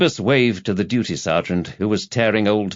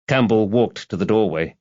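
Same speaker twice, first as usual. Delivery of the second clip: ordinary delivery, nothing marked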